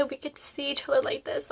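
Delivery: soft whispered confession after crying; teary whisper. Real, not acted. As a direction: whisper it like a secret between family. Apologizing to viewers after crying.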